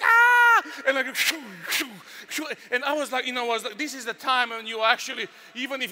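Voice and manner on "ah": high piercing scream, much louder and higher; loud high panicked scream; terrified scream. Retold or imitated. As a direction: shriek in terror on the drop. Screaming on the coaster drop.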